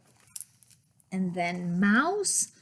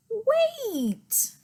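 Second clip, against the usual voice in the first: Wait shot up high and held; high urgent wait; 12 semitones higher than usual. Acted. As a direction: stop them with a high wait. Bear stops the friends.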